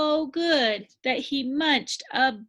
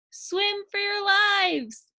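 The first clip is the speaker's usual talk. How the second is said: panicked shout; 8 semitones higher than usual. Acted. Fish flee scary squid.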